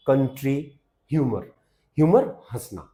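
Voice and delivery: ordinary talk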